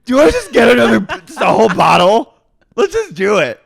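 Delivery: high, loud, boozy sing-song; drunk Miranda. Retold or imitated. Miranda urging friends to split a bottle, loud boozy voice. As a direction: push the whole bottle on everyone with a big tipsy voice. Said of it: "she goes".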